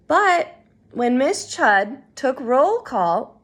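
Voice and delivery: ordinary delivery, nothing marked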